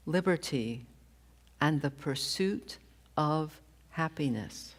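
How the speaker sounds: slow, deliberate reading of a founding text, phrase by phrase. Shown as a demonstration. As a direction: read slowly, with weighty pauses. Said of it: "I'm going to read this slowly".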